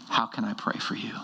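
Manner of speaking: whispered, soft question; hushed recall. Retold or imitated. Former opponent remembering Grant's question, whispered softly. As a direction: whisper it like a treasured memory. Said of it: "what I remember about that".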